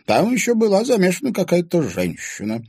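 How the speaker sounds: put-on high, loud old woman's voice; old woman telling the past; reminiscing old woman. A narrator's reading. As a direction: recall old times.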